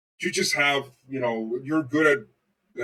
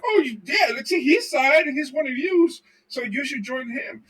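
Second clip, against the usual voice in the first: mock priests; 9 semitones higher than usual. Priests voiced persuading people.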